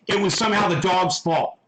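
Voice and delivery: usual speaking voice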